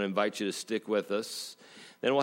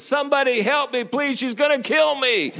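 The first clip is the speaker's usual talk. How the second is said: put-on high, loud desperate child's wail; a four-year-old pleading as mom hauls him out; panicked little kid; 7 dB louder than usual. Preacher voicing a kid over mom's shoulder, high panicked plea.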